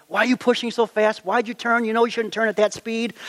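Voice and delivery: lightly acted finger-pointing at his buddy; mock blaming. Teen coworker blames his buddy for the spill, mock accusing. Blaming game between two teens.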